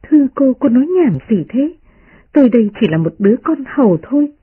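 a hint of a maid urging caution; gentle maid pleading. Maid Tập Nhân voiced.

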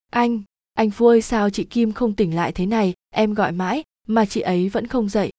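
ordinary delivery

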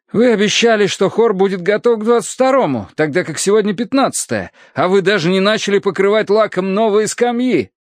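high-pitched fussy reprimand; scolding clergyman. A narrator's reading. Pale clergyman rebukes a workman, scolding. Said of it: "выговаривал бледный священник".

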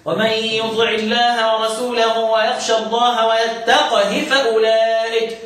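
ترتيل بطيء بقاف ساكنة في "ويتقه"; كسر هاء "ويتقه" بلا مد بعد قاف ساكنة; قراءة حفص. Shown as a demonstration. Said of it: "سيدنا حفص هيقول ايه". (رتّل على مهل بقراءة حفص)

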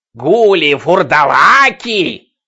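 slow shrill shrieking; piercing scream. Acted. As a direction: wail it like a crazed woman. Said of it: "пронзительный крик".

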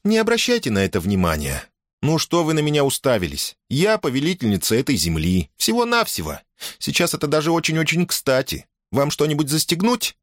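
put-on high-pitched, defensive girl's voice; flustered girl. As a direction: play down your title, embarrassed. Girl covers her embarrassment.

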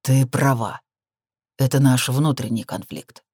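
grudging admission, slowing down; understated, effortful agreement; forced out. A narrator's reading. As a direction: concede it through reluctance. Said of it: "словно через силу произнёс он".

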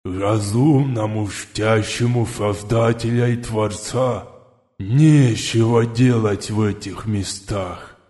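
slow lisping lizard voice; slushy lisp. A narrator's reading. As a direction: lisp the words slowly like a reptile. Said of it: "добавил Шепелявый".

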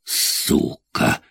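low-volume, whispery swear word; muttered curse. A narrator's reading. Richard curses at Jack's warning, muttering it. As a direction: curse under your breath. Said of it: "выругался Ричард".

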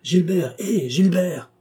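appel fort et haut vers Gilbert; le héler de loin, fort; appel crié. A narrator's reading. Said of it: "en criant". (crier son nom pour l'arrêter)

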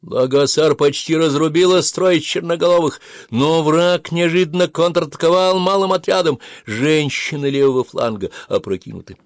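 high, loud, urgent delivery; shouted battle report. A narrator's reading. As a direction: report the setback over battle noise. Shouted battlefield report back to commander.

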